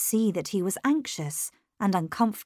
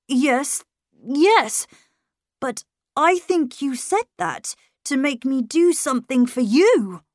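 higher, louder, mildly teasing; as if joking; 6 semitones higher, 5 dB louder than usual. A narrator's reading. Miles hiding nerves from governess, as if joking. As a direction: make light of it, pitched up. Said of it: "he managed to sound as if he were joking".

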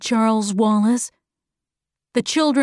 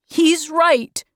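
lightly voiced boy, pitched higher; supportive boy; 6 semitones higher than usual. Calvin agreeing with Charles Wallace, higher supportive boy. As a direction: back him up in a higher voice.

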